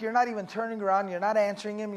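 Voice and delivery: ordinary talk, nothing marked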